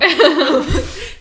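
high loud burst of laughter. Real, not acted. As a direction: break out laughing hard. Laughs hard.

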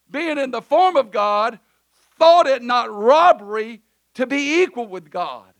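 ordinary talk, nothing marked

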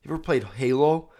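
usual speaking voice

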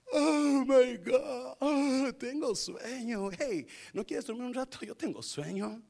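hammed-up drowsy whine; sleepy Peter. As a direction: play a comically sleepy prisoner, stretching the words. Acts sleepy Peter to guards.

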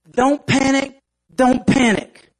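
a hint of an earnest nerdy ant; calm the crowd like an eager know-it-all. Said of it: "the real nerdy looking ant".

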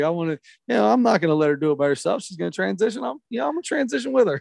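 high, fast excited dad voice; excited dad impression. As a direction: rattle it off like an eager dad. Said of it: "He starts talking about".

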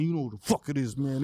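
ordinary talk, nothing marked

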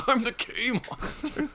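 high, slow, spaced-out drawl; dopey drunk announcing himself; drunk dazed voice. Retold or imitated. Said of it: "I just looked up and went".

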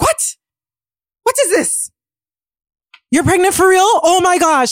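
imitating a stunned mom going way up in pitch; mom's shocked disbelief. Mom finds out about pregnancy.

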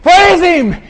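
high, full-throated rallying shouts; very high, loud bursts of urging; cheerleader yell. Acted. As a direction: whip up the crowd like a cheerleader. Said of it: "almost like a cheerleader".

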